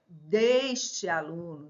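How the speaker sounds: drawn-out "deste" with a closed E, "DÊS-te". Shown as a demonstration. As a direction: lengthen the narrow ê of "deste". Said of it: "Pronúncias diferentes".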